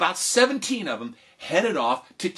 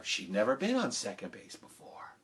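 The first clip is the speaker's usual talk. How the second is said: whispered, lower and softer; hushed aside; 7 semitones lower, 6 dB quieter than usual. A narrator's reading. Storyteller confiding a little joke to the audience, whispered. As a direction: let it slip as a secret aside.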